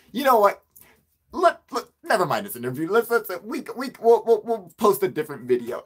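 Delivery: high, loud, stammering dismissal; fed-up outburst. Retold or imitated. Interviewer scraps the interview, loud and fed up. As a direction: imitate someone losing patience, stumbling over words.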